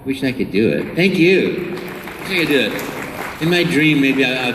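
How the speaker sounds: ordinary talk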